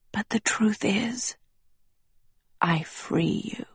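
soft, whispery schoolmaster; quiet, deliberate near-whisper. Acted. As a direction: confide it in a hushed voice.

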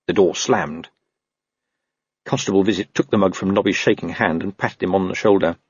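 ordinary delivery

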